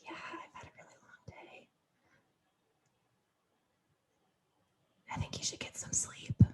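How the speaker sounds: soft, intimate whisper; hushed like someone in your ear; whispered bedtime voice. Retold or imitated. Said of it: "You say".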